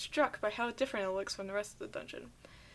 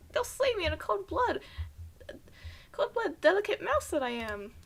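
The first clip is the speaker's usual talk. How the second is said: high, loud, excited little mouse voice; frantic mouse; 7 semitones higher, 5 dB louder than usual. Acted. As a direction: squeak out the plea like a panicked tiny mouse. Mouse explains trap in voice.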